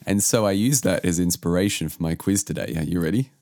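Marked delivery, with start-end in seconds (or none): none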